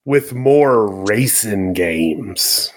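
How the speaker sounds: slow, low continuation of a threat; mock-ominous. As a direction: stretch it out with playful menace.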